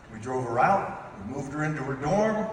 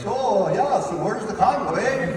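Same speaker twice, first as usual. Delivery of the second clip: put-on higher, louder, singsong Minnesota accent, eh; 7 semitones higher, 5 dB louder than usual. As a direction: ham up a Minnesotan in the back seat. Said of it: "He's like".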